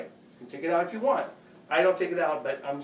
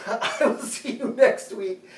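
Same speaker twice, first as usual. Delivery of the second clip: slow, higher, laughing through the words; winded laugh; 5 semitones higher, 4 dB louder, slower than usual. Real, not acted. Vlogger winded while saying goodbye to viewers, laughing. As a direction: break into a breathless laugh while signing off. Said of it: "I don't know why I'm so winded".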